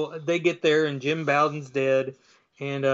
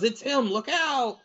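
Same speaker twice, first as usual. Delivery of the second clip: high-pitched startled warning, played up; mock alarm; 8 semitones higher than usual. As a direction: cry out as if suddenly spooked. Haunted trucker sees lights, warns.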